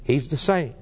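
ordinary talk, nothing marked

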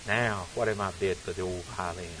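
hushed, low-pitched auctioneer; quiet and low. Retold or imitated. Preacher reciting a poem, the auctioneer asking quietly and low. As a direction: drop it soft and low, reverent. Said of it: "in a voice that was quiet and low".

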